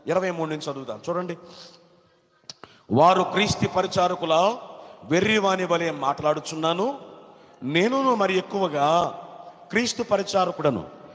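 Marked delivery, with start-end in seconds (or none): 2.9-11.0 loud, declaimed Bible verses; ringing read-aloud, phrase by phrase; forceful scripture reading. Real, not acted. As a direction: proclaim each phrase to the whole church.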